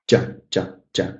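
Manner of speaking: barely bother with the unstressed "Ja"; quiet, almost neglected. Shown as a demonstration. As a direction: throw "Ja" away, short and low. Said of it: "It's kind of quiet".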